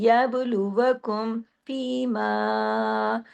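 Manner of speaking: very slow recitation, long held vowels in फीमा; full madd. Shown as a demonstration. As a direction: stretch both vowels of फीमा fully. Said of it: "पूरा हक अदा होगा". (फीमा में मद को पूरा खींचकर)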